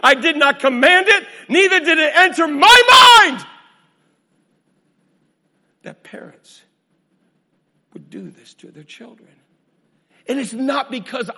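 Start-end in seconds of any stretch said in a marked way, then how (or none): 0.0-3.5 high, loud, building to a shout; God's declaration shouted. Acted. Preacher quoting God to congregation, rising shout. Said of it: "He said". 5.8-9.3 hushed horror. Acted.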